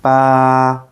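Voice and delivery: "Ba" held flat and steady; long level "Ba", like singing a note; first tone. Shown as a demonstration. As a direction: sustain "Ba" on one even note. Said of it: "Say it like this".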